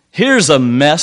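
loud, angry complaint. A narrator's reading. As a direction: spit out the frustration. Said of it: "said the purchaser angrily".

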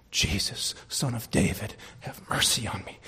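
low plea. Acted. Sinner calling on Jesus despite the devil, low plea. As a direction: pray it low, phrase by phrase. Voices the beggar's prayer, subdued.

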